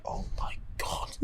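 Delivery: barely voiced, hushed dismay; stunned whisper. Everyone reacts to Sean arriving, stunned whisper. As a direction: whisper it in mock horror.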